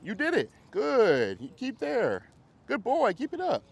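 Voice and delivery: high, bright-pitched dog praise. Real, not acted. Trainer praising dog during drill.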